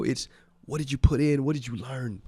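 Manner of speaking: ordinary delivery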